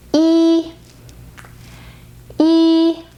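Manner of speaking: "yi" held high and level; first tone. Shown as a demonstration. Teacher introducing the word for one: first tone on "yi", high level. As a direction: hold "yi" long on one high pitch. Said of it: "Yi is the first tone".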